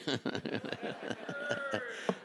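breathy, soft laugh. Real, not acted. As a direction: laugh quietly at your own joke. Laughs at his own Budweiser joke.

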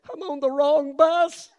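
a sozzled man realizing his mistake; slurring drunk. Retold or imitated. Drunk in the back seat answering the preacher, slow and tipsy. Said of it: "the drunk stood up in the back and said".

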